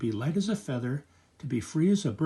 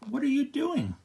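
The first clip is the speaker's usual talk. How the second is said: startled question; 11 semitones higher than usual. Acted. Grandfather startled.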